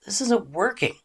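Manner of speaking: candid, exposed plea in short phrases; vulnerable honesty. Shown as a demonstration. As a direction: say it openly, without sarcasm. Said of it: "rather than being vulnerable".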